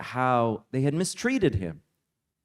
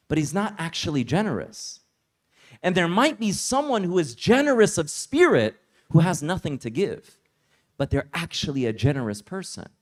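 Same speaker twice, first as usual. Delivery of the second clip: normal talking voice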